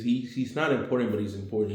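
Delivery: ordinary talk, nothing marked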